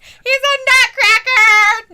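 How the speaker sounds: high laughing squeal. Real, not acted. Laughing at the wrestler on the box. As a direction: laugh it out loud. Laughing repeat, even louder.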